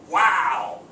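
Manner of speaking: big emphatic outburst; emphatic exclamation. Shown as a demonstration. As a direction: exclaim it with real force. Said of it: "is pretty emphatic".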